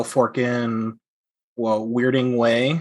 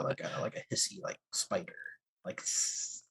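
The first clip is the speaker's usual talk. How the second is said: hissing spider voice, breathy and sibilant; hissy spider whisper; 6 semitones lower, 17 dB quieter than usual. Shown as a demonstration. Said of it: "should my spider voice be like".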